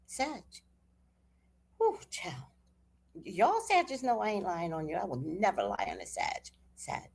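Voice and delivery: ordinary talk, nothing marked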